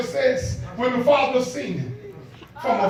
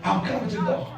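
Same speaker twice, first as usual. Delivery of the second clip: much softer, intimate sermon; hushed preaching; 6 dB quieter than usual. Acted. Pastor softly telling how the father ran to his son.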